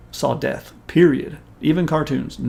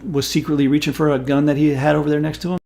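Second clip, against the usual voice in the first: normal talking voice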